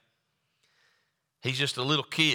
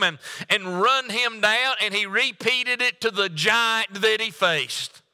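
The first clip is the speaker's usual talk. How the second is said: higher, faster preaching build; 6 semitones higher than usual. Acted. Preacher rousing the congregation about David, fast and higher. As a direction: drive the point home to the church.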